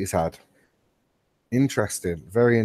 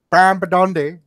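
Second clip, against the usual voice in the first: higher-pitched mock shout; imitated computer shout; 7 semitones higher, 4 dB louder than usual. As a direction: call the name out, imitating a computer. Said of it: "your computer's shouting Brian Badondi at you".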